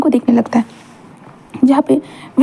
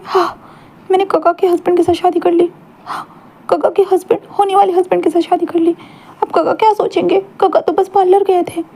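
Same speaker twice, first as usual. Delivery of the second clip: fretting inner voice; 6 semitones higher than usual. Acted. Wing frets in bathtub.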